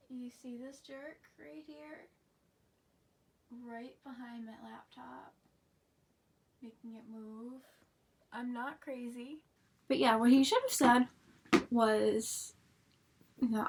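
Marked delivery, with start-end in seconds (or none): 0.1-9.4 very soft and slow, nearly whispered; hushed aside. Real, not acted. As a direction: drop right down to a murmured side remark. Hushed aside about the cat.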